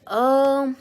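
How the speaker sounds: loud, high, drawn-out exasperated oh; eye-rolling exasperation. Retold or imitated. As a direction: imitate a doctor rolling his eyes. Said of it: "rolls his eyes".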